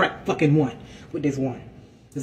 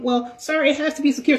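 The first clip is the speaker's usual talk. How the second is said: high, prim mock voice; gym Karen voice; 9 semitones higher than usual. Karen insisting the bag be secure, in a high mock voice. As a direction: mimic her insisting. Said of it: "She talking something".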